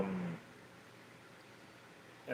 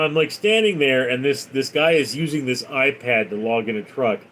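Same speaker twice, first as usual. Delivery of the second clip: ordinary delivery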